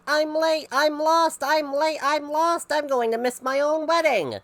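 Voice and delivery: high, loud panicked bride; high frantic yell of a late bride. A narrator's reading. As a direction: yell it, lost and late for the wedding. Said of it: "She was yelling".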